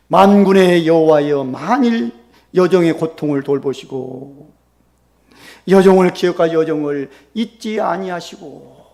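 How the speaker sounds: loud, drawn-out scriptural invocation; solemn vow prayer. Acted. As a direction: call on God with slow, forceful solemnity. Reading out Hannah's vow as prayer.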